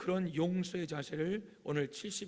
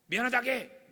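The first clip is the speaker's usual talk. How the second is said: loud, raised mock command; parental order; 6 semitones higher, 7 dB louder than usual. Parent forcing a child to apologize, loud order. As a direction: demand an apology as a stern parent. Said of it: "엄마나 아빠가".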